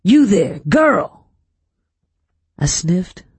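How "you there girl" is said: loud, high-pitched summons; shout. A narrator's reading. Master calling the girl again, shouting harder. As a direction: holler to get her attention. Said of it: "Mister Robert shouted".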